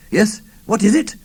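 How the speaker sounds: high, loud schoolmaster voice; loud teacher voice. A narrator's reading. Old schoolmaster answering the four youths, loud teacher voice. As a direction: bark it like a teacher hiding his nerves. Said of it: "in a very loud teacher-type golos".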